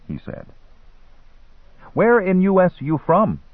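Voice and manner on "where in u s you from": put-on high-pitched boy questioning; broken-English boy, pitched well up. A narrator's reading. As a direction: ask like a young street hustler.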